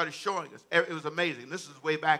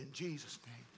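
"In Jesus' name" said low and soft; soft, low call for repetition; hushed prompt; 4 semitones lower, 20 dB quieter than usual. Real, not acted. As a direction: lead the response quietly, prayerfully. First take, said softly to congregation.

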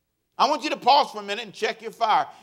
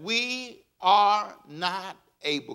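slow, drawn-out call for the congregation; slow reading; much slower than usual. Shown as a demonstration. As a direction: stretch each word so they can follow. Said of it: "Can you read that with me".

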